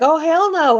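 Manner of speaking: high, loud imitated "oh hell no" from the scared delivery man; a startled man bolting from a barking dog, high and loud; mock alarmed yelp.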